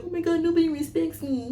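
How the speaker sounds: high, whiny fake sob; imitating her tearful complaint; mock crying. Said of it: "she was crying because she's like".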